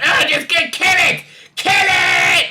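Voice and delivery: high, loud hammed-up yell; mock shouting. Imitating people yelling to kill it, in a mock frenzied shout. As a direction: shriek it as a mock frenzied mob. Acting out others yelling in excitement.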